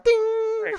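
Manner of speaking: high, loud sung "ding"; imitated bell ding. Co-host adding the spit's sound effect, high bell ding. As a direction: ring out like a struck bell.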